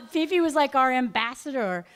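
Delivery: ordinary talk, nothing marked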